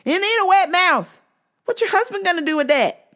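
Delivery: high, loud sassy scolding; high mock-outraged sass; sassy disbelief. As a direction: shut her down with attitude. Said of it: "I'm like".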